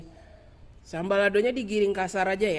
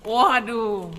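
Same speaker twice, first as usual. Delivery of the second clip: talking while crunching fried quail; words round a crunchy bite; 3 semitones higher, 4 dB louder, slower than usual. Real, not acted. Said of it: "jadi dia kriuk-kriuk ya".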